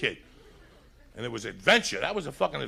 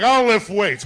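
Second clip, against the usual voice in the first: macho boast; 6 semitones higher, 10 dB louder, slower than usual. Retold or imitated. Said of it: "you're like".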